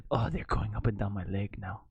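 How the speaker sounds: hushed mock Russian accent. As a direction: lightly put on a Russian accent, low and half-whispered. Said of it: "That's my Russian accent".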